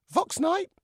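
high, loud puppet query; questioning dummy. Acted. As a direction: pipe up with the name, high. Dummy asks about grandfather.

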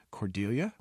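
plain reading voice

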